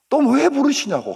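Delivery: high, loud mock complaint; exasperated protest. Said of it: "경기가 나요". (기겁하며 높게 따지는 말투)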